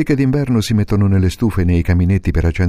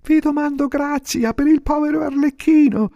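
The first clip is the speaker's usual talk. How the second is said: voce alta da burattino che implora; burattino supplichevole. Acted. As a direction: chiedi grazia con voce acuta. Pinocchio supplica. (burattino che implora con voce acuta)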